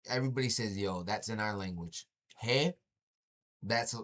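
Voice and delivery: normal talking voice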